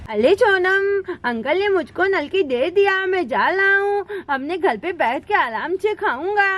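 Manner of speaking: put-on lisping baby-talk voices; excited little children. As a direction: chatter like small children clamouring for a treat.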